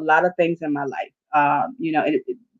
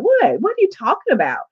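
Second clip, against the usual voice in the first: high, startled disbelief; incredulous what, quick and high; baffled what; 11 semitones higher than usual. Retold or imitated. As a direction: pitch jumps up in retold surprise. Her retold reply to God.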